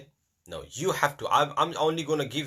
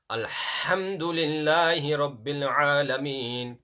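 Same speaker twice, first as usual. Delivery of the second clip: recitation from the breath; 3 semitones higher, 3 dB louder than usual. Shown as a demonstration. Teacher showing students how to begin the recitation. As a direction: start الحمد with a full breath, steady recitation. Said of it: "you have to start with your breath".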